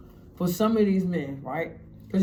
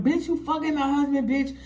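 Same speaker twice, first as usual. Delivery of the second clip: higher-pitched acted accusation; retold tirade, voice lifted; angry confrontation; 4 semitones higher than usual. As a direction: accuse her as the wronged wife. Wife confronts girl at car.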